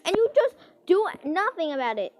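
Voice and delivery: loud, high scolding rant; sassy telling-off. Retold or imitated. Scolding the friend who won't take advice, loud and sassy. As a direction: tell her off loud and sassy. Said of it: "And I'm like".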